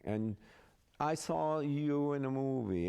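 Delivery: imitated German-accented queen; lightly put-on German accent. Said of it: "she talked like this".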